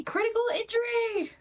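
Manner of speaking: real thrilled shout, high and loud; delighted high shout; excited shout. Real, not acted. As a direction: burst out with a big crit. Rolls a critical on the dice.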